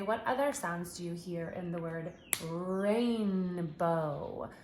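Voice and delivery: slow, stretched teacher question; teacher prompt. Shown as a demonstration. Teacher asking child for sounds in rainbow, slow. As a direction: draw out every word for a child. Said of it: "stretch it out".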